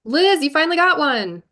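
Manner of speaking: excited. Real, not acted. Chair cheers a seconder.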